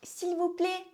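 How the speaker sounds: playful defiance. Pretend. Answering a friend who mocks her accent, playful defiance.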